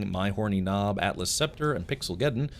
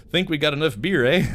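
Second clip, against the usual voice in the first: put-on Canadian accent; 5 semitones higher, 6 dB louder than usual. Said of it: "the very first Canadian in Canada say".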